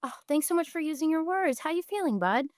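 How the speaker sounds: put-on soft, high, tender voice to a child; warm parental praise. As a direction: gently reassure an upset son. Mother praising son's words.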